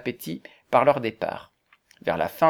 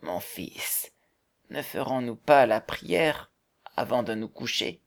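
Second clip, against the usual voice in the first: put-on soft, slow, hesitant old father; gentle old man; 3 dB quieter than usual. A narrator's reading. Old man to Valberg at bedtime, a soft, gentle request. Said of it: "le vieillard, posant doucement sa main".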